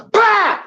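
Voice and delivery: explosive high shouted bang; imitated gunshot. Storyteller firing at another balloon, loud gunshot noise. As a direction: blast it out suddenly.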